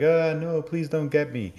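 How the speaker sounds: high-pitched pretend plea for mercy; mock scream. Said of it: "screaming away".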